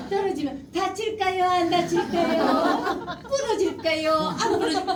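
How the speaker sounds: hammed-up high, singsong questions; mock a parent quizzing a toddler; 아이 어르는 부모. Mimics a too-friendly parent asking child. (과장되게 높은 어르는 말투 흉내)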